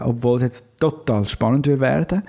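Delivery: ordinary talk, nothing marked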